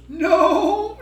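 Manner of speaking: very high, loud mock wail; imitating crying toddler. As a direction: bawl it like a little child. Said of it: "And she's crying and crying".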